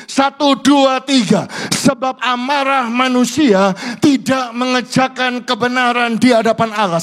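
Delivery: loud, high recitation lead; preaching at full force. Acted. Pastor leading the congregation in recitation, loud. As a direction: call the verse out to the whole church.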